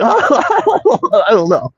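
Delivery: high, slow, drawn-out; mock clueless crowd. Viewers imagined answering, slow high mock voice. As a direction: stretch it out dumb and high.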